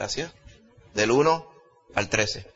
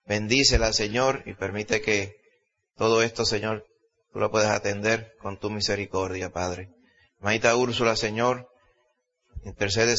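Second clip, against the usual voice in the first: intercessory prayer; 6 semitones lower than usual. Acted. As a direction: bring each request humbly before God. Leads congregational prayer of petitions.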